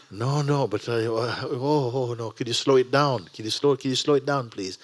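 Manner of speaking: high, loud flustered pleading; panicky student. Retold or imitated. Student begs to slow down.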